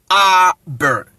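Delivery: ABER split as "AH... ber"; drawn-out open "ah" starting ABER; Akan A in ABER. Shown as a demonstration. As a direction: say ABER with a long "ah" first. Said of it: "the original pronunciation".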